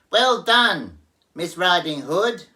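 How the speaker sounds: high, loud cheering piglet; yelling pig. Acted. Pig yells thanks through the window, high and loud. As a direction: yell your thanks. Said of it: "stood and yelled".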